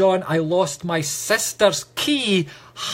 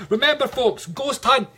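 excited announcement; 9 semitones higher than usual. Real, not acted. Medium plugging his ghost hunt to the viewers, excited call. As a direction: sell the event with a bright call to the viewers.

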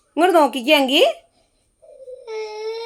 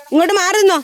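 calling out; 9 semitones higher, faster than usual. Real, not acted. Calling family to step aside.

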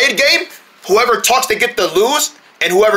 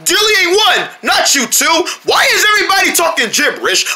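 shout; 8 semitones higher than usual. Acted. Shouting in dismay at the others. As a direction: yell it out in dismay.

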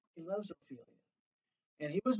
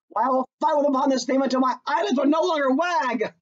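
defiant Hamlet; 7 semitones higher, 4 dB louder, faster than usual. Acted. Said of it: "his best friend says to him".

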